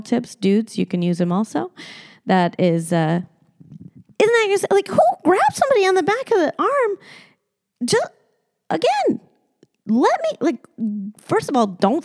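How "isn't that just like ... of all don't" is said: high, fast exasperated outburst; can you believe this, exasperated; indignant. Real, not acted. Indignant about the grab.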